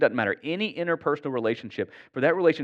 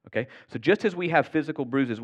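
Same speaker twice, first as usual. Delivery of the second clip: usual speaking voice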